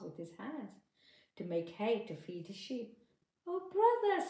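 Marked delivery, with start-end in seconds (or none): 3.5-4.3 much higher, louder mouse call; mouse greeting. Acted. Mouse calling out to the grass-pulling man, high and loud. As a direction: hail the farmer like a tiny eager mouse. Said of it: "said the mouse".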